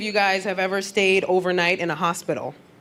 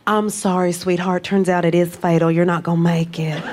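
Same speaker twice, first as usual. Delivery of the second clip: soothing Southern nurse voice, settling lower; comforting Southern accent; 3 semitones lower than usual. Retold or imitated. Southern nurse tells patient it's fatal, comforting Southern accent. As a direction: break it gently in a Southern accent. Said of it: "right Southern accent. It's so comforting".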